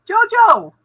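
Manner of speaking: loud high call across the room. Real, not acted. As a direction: shout her name to get attention. Calling out to her again.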